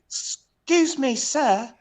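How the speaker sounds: high, slow put-on British accent; mock British sweep. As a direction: draw it out like a formal British tradesman. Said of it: "He said, then there's gonna come back to you".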